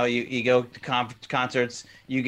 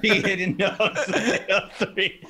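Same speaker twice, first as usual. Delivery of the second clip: laughing; 6 semitones higher, much slower than usual. Real, not acted. Laughing joke about [name].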